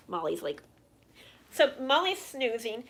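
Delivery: usual speaking voice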